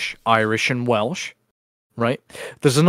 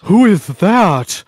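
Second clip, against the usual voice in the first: higher, louder, very slow; mock baffled quote; 6 semitones higher, 5 dB louder, much slower than usual. Host acting out Chad not knowing the name, in a slow mock voice. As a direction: imitate someone clueless, stretching each word.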